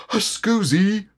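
high, garbled excuse; muffled tiger talking round food; mouth full. A narrator's reading. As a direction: blurt it through a mouthful. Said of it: "his mouth was full of acorns".

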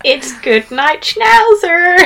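mock-menacing, rising high and loud on Schnauzer; tiny little psychopath. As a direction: sing out the threat in a squeaky psycho voice. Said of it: "tiny little psychopath".

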